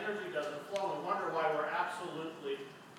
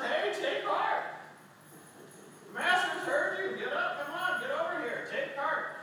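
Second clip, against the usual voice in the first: much higher, bright urging; eager encouragement; 8 semitones higher than usual. Acted. Crowd now eagerly urging the blind man to come. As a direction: cheer him on to come. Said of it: "The crowd says".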